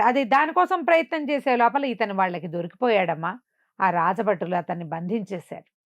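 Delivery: ordinary delivery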